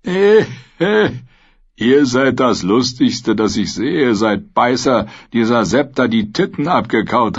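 high mocking sellsword voice, grinning; mocking noseless mercenary, high and jeering. A narrator's reading. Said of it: "Der Nasenlose grinste".

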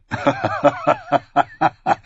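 short mocking laugh; a knight laughing at a child's demand; scornful laugh. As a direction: laugh off the price. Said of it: "Sir Hyle lachte".

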